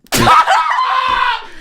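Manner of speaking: high, loud burst of laughter; loud shrieking laugh. Real, not acted. Host cracking up at the replayed clip, shrieking laugh.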